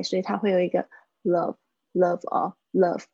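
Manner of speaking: normal talking voice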